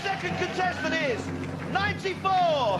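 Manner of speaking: high, drawn-out game show announcing; bingo caller. Acted. Host calling out the number to the studio, drawn-out announcer call.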